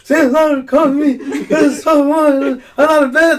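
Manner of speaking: put-on high, loud wailing kid through laughter; hammed-up crying, high and loud; mock bawling. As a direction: wail it like a sobbing child, hammed-up. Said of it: "I just started crying. I was, like, bawling".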